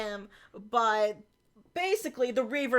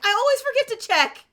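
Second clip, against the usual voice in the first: much higher, fast offhand line; high quick quip; 12 semitones higher, 6 dB louder, faster than usual. Retold or imitated. Acting Griffin's dazed line.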